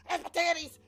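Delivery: high, loud put-on blurt; hammed-up high squawk; brazen flasher. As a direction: play someone running up to show off. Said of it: "you running up to me and going".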